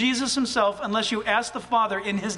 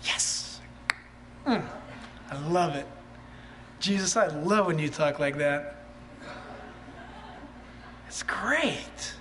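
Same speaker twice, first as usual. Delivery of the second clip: soft, lower, rapt churchgoer savouring the words; delighted agreement; 8 semitones lower, 7 dB quieter than usual. Acted. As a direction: murmur your love for it. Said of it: "Or you could say this morning".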